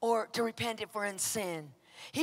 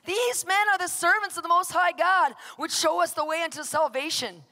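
loud, raised proclamation; crying out; 7 semitones higher, 5 dB louder than usual. Retold or imitated. Possessed woman crying after Paul, proclaiming loudly. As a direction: call it out like a herald. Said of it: "crying and saying these things".

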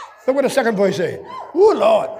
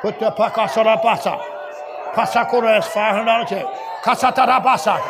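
speaking in tongues; 6 semitones lower, 4 dB quieter than usual. Real, not acted. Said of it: "That's what I'm hearing out of the Spirit".

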